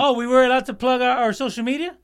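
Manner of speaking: high surprised squeal. Real, not acted. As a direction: caught off guard, voice jumps up. Surprised at missing plug chance.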